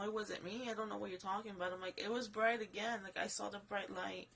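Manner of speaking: normal talking voice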